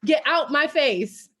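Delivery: high, loud, fast sass at the friend who is giving advice; sassy comeback. Retold or imitated. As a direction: fire it back like a friend who crossed the line. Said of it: "actively wanting to punch her".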